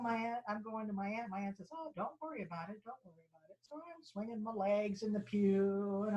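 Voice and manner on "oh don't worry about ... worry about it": hushed reassurance. Retold or imitated. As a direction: quietly brush off a child's worry, as the aunt. Aunt hushing child in church.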